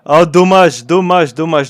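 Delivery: loud, high groaning dommage; loud dismayed groan. Real, not acted. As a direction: react big to the miss. Missed chance in live commentary.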